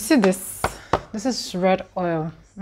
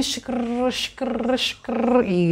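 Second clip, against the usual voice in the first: imitated hot-oil sizzle 'shikr'; a playful sizzling 'shikr' sound; sizzling noise; 4 semitones higher, much slower than usual. Shown as a demonstration. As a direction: hiss it like oil in a pan. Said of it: "As it is doing".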